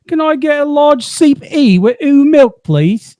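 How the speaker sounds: high put-on British, every T dropped; mock British accent. Mimicking husband ordering at Tim Hortons, mock British. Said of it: "So I would say".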